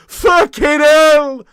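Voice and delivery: loud, slow, stretched-out "Fucking hell!"; drawn-out exclamation. Retold or imitated. Streamer replaying his own reaction in the documentary, stretched loud. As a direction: drag the curse out in dismay. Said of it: "there's me going".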